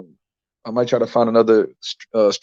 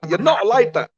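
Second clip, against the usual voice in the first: put-on much higher accented voice; imitating Tunde; 9 semitones higher than usual. Tunde telling Pat off, high accented voice. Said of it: "He told Pat".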